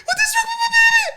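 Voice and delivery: high, loud frantic wail; panicked crying mother. Retold or imitated. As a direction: shriek it like a terrified new mother. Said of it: "she started crying".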